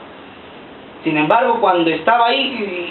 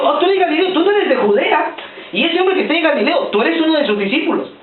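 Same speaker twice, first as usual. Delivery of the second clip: higher, pointed accusation; accusing servant girl; 8 semitones higher than usual. Retold or imitated. Servant girl accusing Peter, higher pointed voice. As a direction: call him out like a nosy maid.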